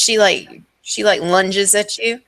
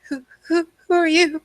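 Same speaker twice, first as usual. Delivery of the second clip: higher, stammering frightened woman; startled madwoman; 10 semitones higher than usual. Acted. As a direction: play her startled and unsure, stumbling on the words. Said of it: "she's like".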